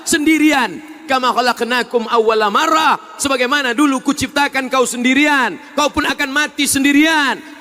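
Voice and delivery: loud, rising sermon delivery; fiery preaching. Acted. Preacher warns the congregation they die alone, loud. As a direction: drive it home to the congregation.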